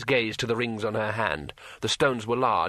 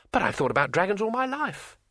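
excited; 8 semitones higher than usual. Acted. Two Flower excited.

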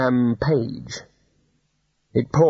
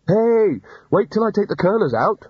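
high, loud call; raised, high-pitched shout; shouting after someone; 8 semitones higher, 3 dB louder than usual. A narrator's reading. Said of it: "shouted after it".

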